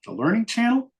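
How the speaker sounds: high, rising clueless question; retold baffled query; puzzled guess. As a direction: guess wildly, voice climbing. Clueless wrong guess at band.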